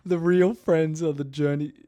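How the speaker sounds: high, loud, laughing through the words; giggly mangled quote. Real, not acted. Botching a famous quote aloud, laughing high. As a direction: crack up while saying it.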